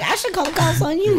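high mocking taunt; sassy comeback. Kid to his mom, high cheeky taunt. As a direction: throw it back at her cheekily.

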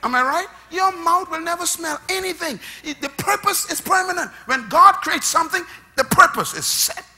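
loud, high preacher's cry; full-force preaching. Acted.

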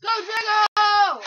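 loud, slow urging call; drawn-out shout. Real, not acted. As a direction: cheer the dog on slowly. Urging the dog.

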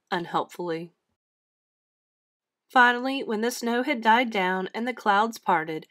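plain reading voice

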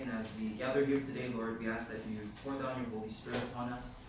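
ordinary talk, nothing marked

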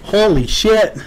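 loud, high shocked outburst; surprised exclamation. Real, not acted. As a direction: blurt it out in shock. Reacts to the reveal.

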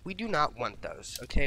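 ordinary talk, nothing marked

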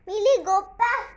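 loud, high fake scolding; hammed-up angry shout at the dog; mock yell. Fake yelling at dog for test.

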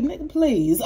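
dismissive scoff. Retold or imitated. Libra brushing off the jealous ex, loud dismissive scoff. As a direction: wave it off like it's nothing. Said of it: "You like".

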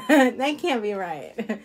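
words slowed by real laughter; amused laugh while talking. Real, not acted. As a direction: chuckle through it, slowly. Laughing at a viewer comment.

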